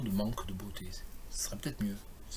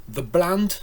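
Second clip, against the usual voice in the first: "The bland" set apart, higher and louder; isolated line ending; 5 semitones higher, 8 dB louder than usual. Shown as a demonstration. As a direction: leave "The bland" hanging alone before a pause. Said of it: "the bland est tout seul". (« The bland » isolé, plus haut et fort)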